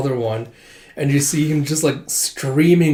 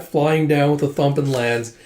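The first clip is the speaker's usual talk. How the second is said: ordinary talk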